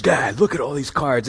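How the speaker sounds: lightly mimicking a grown son's boyish excitement; a touch of little-kid glee, imitated; excited kid. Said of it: "he's all excited. He's like a little kid again".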